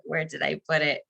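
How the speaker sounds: usual speaking voice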